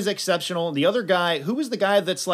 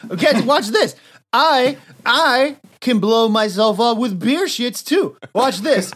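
higher-pitched cocky boasting; drunken showoff; 5 semitones higher, 3 dB louder than usual. Acted. Cannonball shows off to his team, high cocky boasting. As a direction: draw it out like a daredevil announcing a stunt.

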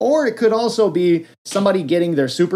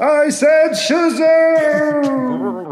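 very slow, much higher heroic yell; epic shout; 12 semitones higher, 4 dB louder, much slower than usual. Retold or imitated. Kid calling the magic word, slow epic shout. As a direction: stretch out the magic word like a movie trailer. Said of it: "kid yelling the magic word".

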